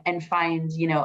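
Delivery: ordinary delivery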